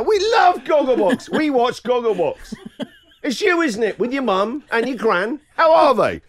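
high, excitable fan imitation; loud gushing. Wife to the Gogglebox lady in the salon, loud mock gushing. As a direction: gush it out for the whole room to hear. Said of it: "so loudly now".

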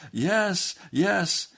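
high, loud repeated assent; reenacted fervent yes; desperate seeker. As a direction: cry out eager agreement.

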